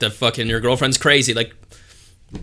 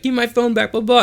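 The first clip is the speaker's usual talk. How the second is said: mock screaming woman; 10 semitones higher than usual. Girlfriend beside the car screaming, mocked in high voice. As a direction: mimic her screaming, tossed off as blah-blah. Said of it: "screaming at me".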